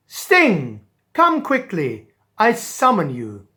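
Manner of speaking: loud, urgent summons; royal call. Acted. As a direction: call the stingray fast and grand. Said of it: "he called to the stingray".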